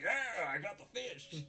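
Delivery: high gleeful shout of triumph; excited cheer. Retold or imitated. Student retelling anime hero shouting with glee. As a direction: crow it like you just speared a prize. Onizuka voiced in anime recap.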